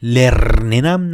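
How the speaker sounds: لرننم with a long, loud trilled R; exaggerated trill in لرننم, slow and loud; wrong rolled R. Shown as a demonstration. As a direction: roll the R hard in لرننم. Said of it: "لرننم نه".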